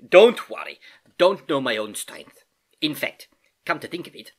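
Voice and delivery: put-on accented old uncle, lively and rueful; good-natured Uncle Albert. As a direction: play the uncle making light of his mishap. Voices Uncle Albert in the story.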